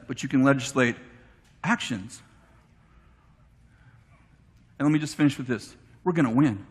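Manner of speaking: preacher's hushed closing exhortation; preaching. Acted. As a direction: bring it home in a low, intense tone. Closing preaching, held low.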